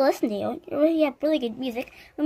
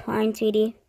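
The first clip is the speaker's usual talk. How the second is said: ordinary delivery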